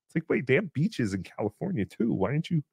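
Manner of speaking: usual speaking voice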